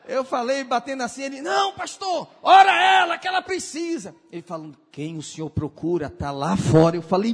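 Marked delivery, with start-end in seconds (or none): 1.4-4.1 high, loud pleading yell; urgent shout. Retold or imitated. Neighbor outside shouts at the pastor to pray, high and loud. 4.9-6.9 lower, menacing possessed tone; lightly voiced sinister spirit; low demon voice. Possessed woman taunts the pastor in a lightly lowered demon voice.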